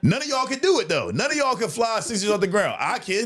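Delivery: mock taunt half-laughed; laughing boast. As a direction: gloat while cracking up.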